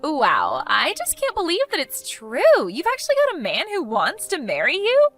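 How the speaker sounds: theatrically flabbergasted; amazed disbelief. Acted. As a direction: gush with insulting astonishment. Amazed that rival will marry.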